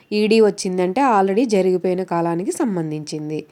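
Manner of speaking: ordinary talk, nothing marked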